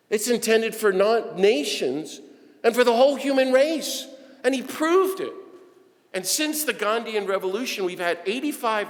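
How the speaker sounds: preaching at full force. Acted. Preacher rouses the church about Gandhi, full-force preaching. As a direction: drive the point home to the congregation.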